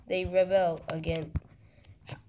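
normal talking voice

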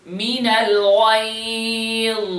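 slow, melodic tajweed recitation; Quran recitation. Shown as a demonstration. Teacher recites for tajweed students, slow melodic recitation. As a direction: chant it slowly like a reciter.

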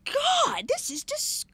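high, drawn-out exclamation; horrified disbelief. Retold or imitated. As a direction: re-enact being appalled in the moment. Retold horror in the moment.

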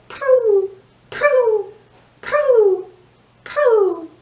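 high, loud cooing prue; pigeon coo. Shown as a demonstration.

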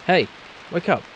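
voiced call to a man lying in the rain. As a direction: shout to rouse a sleeper. Calling to unconscious man.

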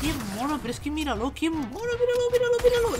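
soft, high-pitched cooing; cutesy cooing. Real, not acted. Player admiring something cute, soft cooing. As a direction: coo over something adorable, quietly.

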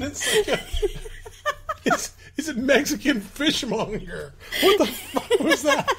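giggling and climbing high through the words; laughing. Real, not acted. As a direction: laugh through every word.

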